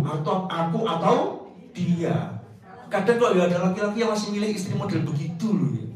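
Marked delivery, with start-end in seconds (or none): none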